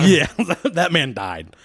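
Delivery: laughing through it; giggling while saying that man died. Real, not acted. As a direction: laugh out the words.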